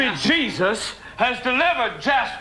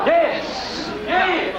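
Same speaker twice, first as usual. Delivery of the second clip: fervent revival cry; preacher's shout; 6 semitones higher than usual. Acted. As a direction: shout it out to the congregation. Preacher whipping up the congregation.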